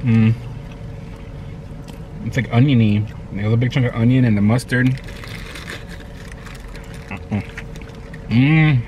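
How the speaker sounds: food in mouth; muffled by food; talks while chewing. Real, not acted. Seen 3: left man bit sandwich before, jaw working during words.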